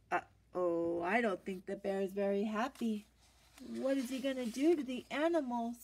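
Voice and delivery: plain reading voice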